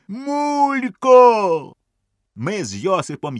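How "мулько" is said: high, slow, loud despairing yell; desperate shout. A narrator's reading. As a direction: wail that you can't wade out. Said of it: "кричить". (розпачливий крик)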